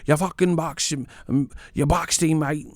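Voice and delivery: high mock British accent, praising the boxing; mock British fan. Mocking British fans who now praise AJ's boxing, high mock accent. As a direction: switch to praising points wins like a fickle Brit. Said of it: "They're like".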